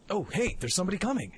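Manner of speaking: normal talking voice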